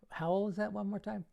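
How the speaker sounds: high, playful fake confusion; mock puzzled. Shown as a demonstration. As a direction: play dumb for laughs.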